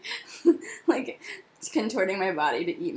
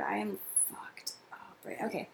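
whispering in pain; 12 dB quieter than usual. Real, not acted. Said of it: "oh my God".

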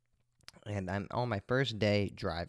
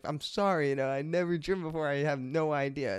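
higher, fast nervous apology; a hint of squeaky retold apology; apologizing; 5 semitones higher than usual. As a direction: rush out a sheepish sorry. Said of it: "I was pretty much saying".

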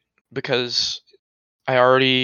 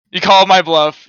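loud; 6 semitones higher, 10 dB louder than usual. Acted. Mock boyfriend caught out.

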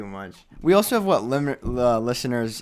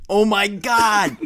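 high, loud, drawn-out exclamation; real astonished cry, stretched out; amazed outburst; 8 semitones higher, 6 dB louder, slower than usual. Real, not acted. Host hearing of Musk's challenge, astonished shout. Reacting to absurd combat challenge news.

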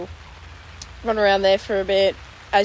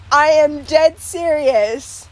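slow, loud, higher, word by word; acted-out deadly earnest vow; emphatic insistence; 7 semitones higher, 5 dB louder, much slower than usual. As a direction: spell it out slowly so they believe it. Acting insistence to disbelieving friends.

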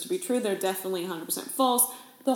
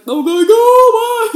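much higher and much louder dismissal; shooing imitation; 13 semitones higher, 16 dB louder, slower than usual. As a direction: shoo the staff off in a squeaky yelp. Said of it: "And he's like".

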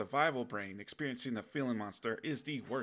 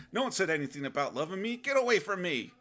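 higher, louder, pushing someone away; hammed-up alarm, building to a louder protest; mock panicked refusal; 8 semitones higher, 6 dB louder than usual. Acts out pushing love away.

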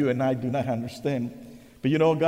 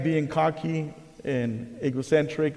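ordinary talk, nothing marked

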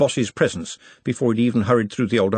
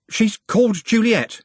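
higher, urgent blurt; gasped; 12 semitones higher, 4 dB louder than usual. A narrator's reading. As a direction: blurt it out to escape the questioning. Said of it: "he gasped".

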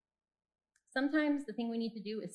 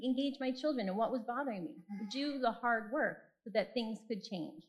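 normal talking voice

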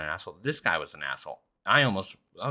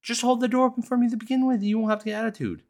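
high, loud, exasperated rant at the rude man; indignant rant; 6 dB louder than usual. Real, not acted. Complaining about the man who let the door slam, indignant. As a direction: let the annoyance spill over, pitched up.

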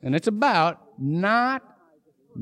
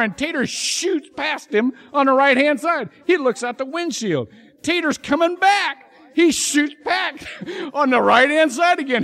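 building excitement; 6 semitones higher, 3 dB louder than usual. A narrator's reading. Car chase climax of joke.

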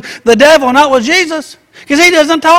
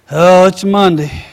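mock-weary, low and resigned; downbeat reply; 4 semitones lower than usual. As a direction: put on a mild Monday-blues sigh of a voice. Acting a downbeat reply to greeting.

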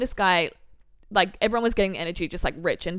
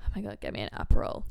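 whispered relief; 4 dB quieter, faster than usual. Retold or imitated. Exhausted on reaching the hotel.

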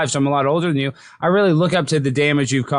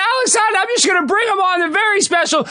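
booming, much higher circus announcer; ringmaster. Acted. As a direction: shout it out like a big-top ringmaster. Hypes guest like circus intro.

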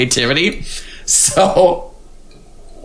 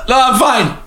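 laughing; 11 semitones higher than usual. Real, not acted. Laughing through hiccups.

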